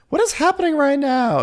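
high mock [name] voice; [name] impression. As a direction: squeak it out like a flustered [name].

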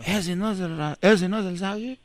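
soft muttered aside; muttering. Acted. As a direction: mutter it to yourself.

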